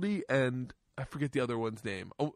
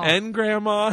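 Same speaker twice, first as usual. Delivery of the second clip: higher, louder tearful cry; crying shout; 8 semitones higher, 8 dB louder, slower than usual. Retold or imitated. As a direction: sob out the name. Daughter wailing.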